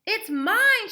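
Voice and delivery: put-on high loud child yell. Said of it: "she yelled".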